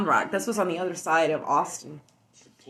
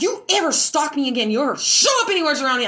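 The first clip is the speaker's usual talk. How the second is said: angry threat; 6 semitones higher, 4 dB louder than usual. Retold or imitated. Threatening the stalker.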